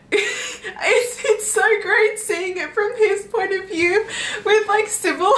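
high, squeaky laughing voice; giddy delight. Real, not acted. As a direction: gush through giggles, drawing it out. Delighted laughing over the book.

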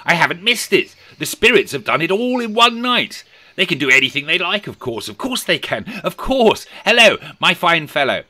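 high, loud excited babble; giddy delight. Acted. Scrooge relieved he hasn't missed Christmas, giddy. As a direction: let relief bubble up into exclamations.

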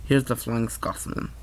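Scottish voice. Shown as a demonstration. Kid showing off his Scottish accent on a toy train. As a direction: announce the engine in a mild Scottish lilt. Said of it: "I think I do good with Scottish voice".

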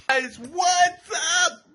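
high, slow laughing greeting; laughing shout. Real, not acted. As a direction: burst out laughing as you greet.

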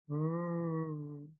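R sound demo. Shown as a demonstration. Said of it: "blocked by the tongue, teeth, or lips".

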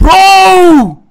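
loud, high, drawn-out cry; shocked shout. Real, not acted. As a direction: yell it high in disbelief. Startled shout at game.

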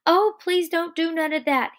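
high, loud frightened plea; begging. Acted. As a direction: beg desperately for it to stop. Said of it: "he begged".

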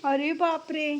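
normal talking voice

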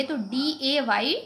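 डीएवाई spelled slowly, one letter at a time; slow spelling. Shown as a demonstration. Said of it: "वाई का pronunciation नहीं हो रहा".

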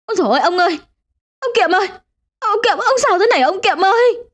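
high-pitched frantic cry; panicked shriek. A narrator's reading. Wife finding her husband collapsed, high panicked shrieking. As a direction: shriek out in alarm for your husband. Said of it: "Bà Đậu rú lên".

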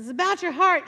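riled-up preacher. Retold or imitated. Preacher to his cheering church, fired-up call. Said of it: "he would get into this kind of rhythm".

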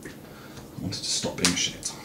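slow, breathy whisper to himself; a quiet sigh of a resolve; whispered self-reproach. Real, not acted. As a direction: barely voice it, half to yourself. Repeats the resolve under his breath.

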